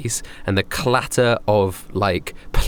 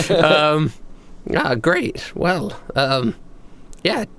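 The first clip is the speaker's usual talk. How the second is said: higher, forced polite cheer; awkward Heather; 8 semitones higher than usual. Acted. Heather greets old acquaintance, high forced cheer. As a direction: fake friendliness with a nervous laugh.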